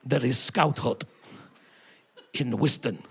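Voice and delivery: mock Russian accent. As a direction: ham up a Kremlin aide's Russian accent. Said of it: "met Mister Putin and said".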